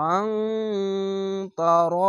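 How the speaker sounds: "আং" hummed long, then a thick, stretched "র"; heavy r, ghunna. Shown as a demonstration. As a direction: hold the ng of "আং", draw out a heavy "র". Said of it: "মোটা করে পড়বেন".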